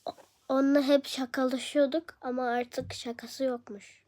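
ordinary talk, nothing marked

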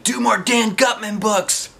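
high, slow, pleading fan voice; mock pleading fans. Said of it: "all those of you who've been saying".

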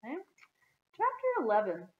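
usual speaking voice

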